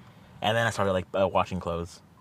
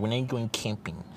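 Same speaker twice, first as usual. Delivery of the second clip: a hint of a smug friend drawling it slowly; lightly voiced teasing friend, slowed; 2 semitones higher, 4 dB quieter than usual. Friend teasing the speaker about the trip, lightly mocking and slow. Friend repeats they're not camping.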